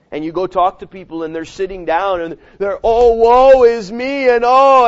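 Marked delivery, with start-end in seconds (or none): 2.8-4.9 loud, slow mock lament; hamming up a self-pitying moan; mock woe. Preacher mocking discouraged people's hammed-up wailing. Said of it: "they're crying and they're sobbing".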